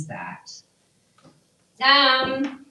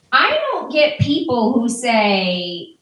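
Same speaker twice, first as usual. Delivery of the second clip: ordinary talk, nothing marked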